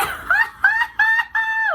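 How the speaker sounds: very high, loud shrieking laugh; squealing laugh. Real, not acted. Giddy over the perfume.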